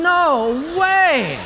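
drawn-out, loud incredulous protest; loud disbelief. Acted. Holy guy hears the wrong answer accepted, loud disbelief. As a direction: wail it in disbelief.

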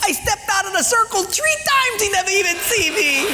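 very high, triumphant comic squeal; gleeful trickster. Acted. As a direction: boast with giddy delight, accent put on. Punchline in the gleeful man's voice.

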